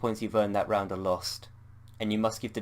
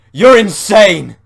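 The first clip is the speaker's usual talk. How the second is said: slow, much higher, much louder shout; furious shout; 11 semitones higher, 21 dB louder, much slower than usual. Acted. Bob shouting at Anne that she's insane. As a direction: hurl it at her in horror.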